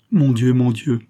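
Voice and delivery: put-on girl praying aloud for her lover, crying out. A narrator's reading. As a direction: cry out to heaven. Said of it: "s'écria-t-elle".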